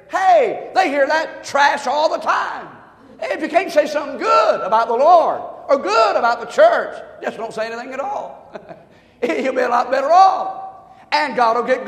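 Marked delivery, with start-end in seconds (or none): 0.1-6.9 preaching full force. Real, not acted. Preacher exhorting the church.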